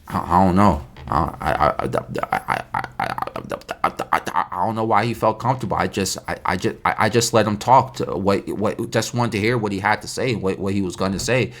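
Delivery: low, stammering excuse; stumbling over words. Retold or imitated. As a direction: mimic someone cornered, tripping on every "I". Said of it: "stumbling over their words".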